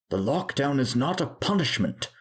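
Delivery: yelling doctor. A narrator's reading. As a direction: yell it as an angry reprimand. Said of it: "yells Doctor Stadler".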